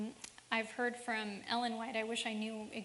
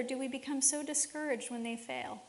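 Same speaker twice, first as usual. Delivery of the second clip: usual speaking voice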